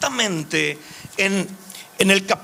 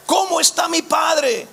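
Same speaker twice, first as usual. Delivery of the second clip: slow, higher-pitched, drawn-out declaration; José's revelation; 6 semitones higher, slower than usual. Acted. José reveals himself to his terrified brothers, slow proclamation. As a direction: declare it in José's voice, stretching each word. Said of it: "Cuando, cuando José les dice".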